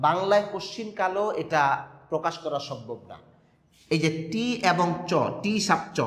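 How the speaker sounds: normal talking voice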